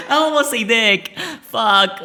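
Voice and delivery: loud, high, breaking up with laughter; helpless high-pitched giggling; laughing hard. Real, not acted. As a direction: struggle to get it out through laughter. Laughing at own near slip.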